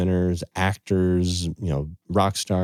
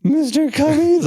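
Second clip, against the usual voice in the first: imitating a tiny boy, much higher and louder; little boy voice; 7 dB louder than usual. Retelling his son's words to Mr. Cummings, high child voice. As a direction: play the shy little son, voice way up. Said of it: "trying not to cry".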